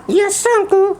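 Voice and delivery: put-on high, slow child puppet voice; puppet boy voice. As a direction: play the cheeky little boy puppet.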